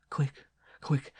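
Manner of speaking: nun to night nurse, whispered; soft urgent whisper. A narrator's reading. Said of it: "Softly, so as not to disturb".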